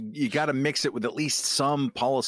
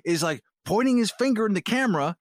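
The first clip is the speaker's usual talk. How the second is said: high, exasperated disbelief; can't believe he actually said it; incredulous; 9 semitones higher than usual. Real, not acted. Disbelief at a real quote.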